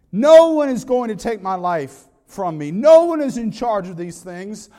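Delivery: loud declaration in the pulpit; forceful preaching. Real, not acted. Preacher voicing Jesus to the congregation, loud and commanding. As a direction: hit each opening "No one" hard.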